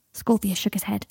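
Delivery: ordinary delivery, nothing marked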